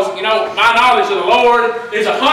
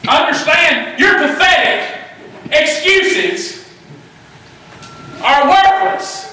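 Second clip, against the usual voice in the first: full-force preaching; 7 semitones higher, slower than usual. Real, not acted. Preacher rebuking the congregation's excuses, rising shout. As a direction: drive it home to the church like a fire sermon.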